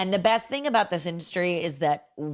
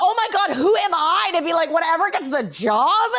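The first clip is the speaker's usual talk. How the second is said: loud, high, incredulous outburst; horrified self-mockery; 10 semitones higher, 6 dB louder than usual. Mother aghast at what she just said, horrified shout. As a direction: shout it high, appalled at your own words. Horrified at her own blurted remark.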